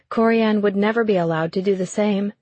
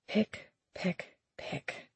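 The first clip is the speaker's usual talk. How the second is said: softer and softer, the last word breathed; fading whisper; 14 dB quieter, much slower than usual. Acted. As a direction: whisper the echo as it fades away. Echo in her head.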